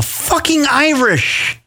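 put-on a weak stab at an Irish accent; bad Irish accent. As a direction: ham up a failed Irish accent. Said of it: "I can't do a fucking Irish accent".

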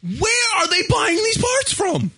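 exasperated shout. Real, not acted. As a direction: yell it in disbelief.

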